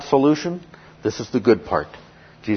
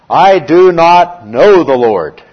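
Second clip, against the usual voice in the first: loud, slow and higher, a proud king's refusal; defiant Pharaoh; 6 semitones higher, 11 dB louder, slower than usual. Retold or imitated. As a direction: boom it out slowly like a haughty Pharaoh. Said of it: "And what is Pharaoh's response?".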